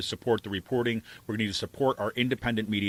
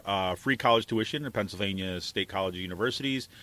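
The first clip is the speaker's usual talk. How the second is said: usual speaking voice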